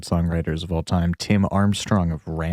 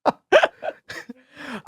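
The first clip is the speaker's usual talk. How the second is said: laughing; 5 semitones higher than usual. Real, not acted. Laughing at own failed attempts.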